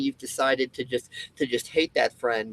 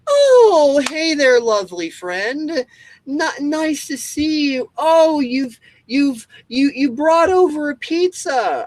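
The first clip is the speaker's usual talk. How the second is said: high, loud, syrupy fake-delighted voice; hammed-up overly grateful friend; mock sweetness; 10 semitones higher, 7 dB louder than usual. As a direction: gush over the friend with exaggerated sugary thanks. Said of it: "Were you like".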